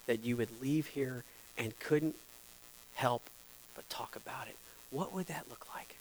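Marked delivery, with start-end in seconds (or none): 3.7-5.9 hushed whisper. Acted. Pastor hushing the congregation to ponder, in a whisper. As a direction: drop to a breathy whisper.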